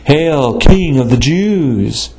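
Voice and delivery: slow, drawn-out mock salute to a false king; lightly voiced soldiers, sneering and slow. A narrator's reading. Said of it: "mocked him".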